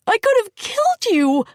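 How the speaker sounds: high frightened cry; panicked. Acted. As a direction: scream it in terror. Panic after killing.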